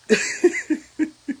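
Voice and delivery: high burst of laughter. Real, not acted. Recalling [name], a real high laugh. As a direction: laugh it off at the memory.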